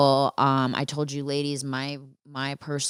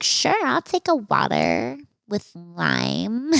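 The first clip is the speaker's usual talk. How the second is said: higher, drawn-out campy drink order; 6 semitones higher, slower than usual. Retold or imitated. Date asking for a drink, mocked as effeminate and drawn-out. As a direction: mimic the date, stretching the words up high.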